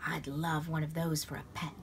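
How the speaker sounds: ordinary delivery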